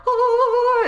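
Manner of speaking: high, loud wailing cry; hammed-up sobbing wail, imitating; mock bawling. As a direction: burst into fake blubbering. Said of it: "he starts crying".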